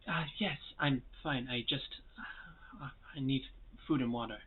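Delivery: very soft, halting reassurance; weak and weary. Acted. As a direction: speak faintly, drained of strength. Exhausted rescued dwarf woman speaks.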